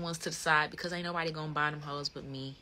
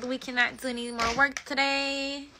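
louder, higher, upbeat goodbye; excited sign-off; 6 semitones higher, 11 dB louder than usual. Real, not acted. Saying goodbye to viewers, louder and higher. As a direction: wrap it up with energy.

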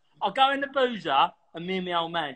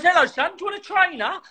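high, loud imitation of a landlord; dad calling out; 13 semitones higher, 3 dB louder than usual. Dad offers a pub customer a drink, calling out. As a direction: offer it eagerly in a raised voice. Acting dad's muddled offer.

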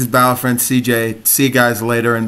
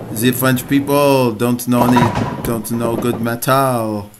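put-on French-tinged accent, drawn out at the end; hammed-up foreign accent, French-style; mock French accent. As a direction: do a jokey thick French accent. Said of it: "that was my French accent".

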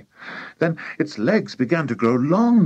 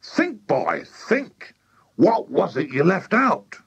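pitched-up, pressing demand; urgent cry; 7 semitones higher, slower than usual. A narrator's reading. Mister Cranky pushes George to remember, urgent cry. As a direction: press the boy for an answer. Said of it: "cried Mister Cranky".

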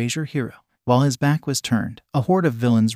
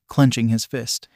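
ordinary delivery, nothing marked

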